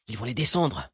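ordinary delivery, nothing marked

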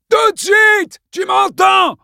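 high, loud, slow frantic scream; almost demented yell. Acted. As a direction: yell like he is losing his mind. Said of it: "hurla Mike d'une voix presque démente". (hurlement presque dément)

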